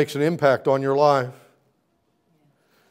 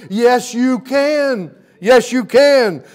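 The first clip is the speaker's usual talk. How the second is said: slow, high, shouted yes; roaring reply of hope; triumphant shout; 10 semitones higher, 8 dB louder, much slower than usual. Acted. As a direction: call it out like a victory cry. Shouted answer of resurrection.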